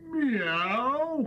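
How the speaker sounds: long sung meow; cat meow. Pretend. Child sings the cat's meow. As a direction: croon it like a cat. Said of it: "The cat sang".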